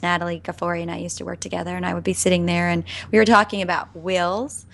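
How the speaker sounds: ordinary delivery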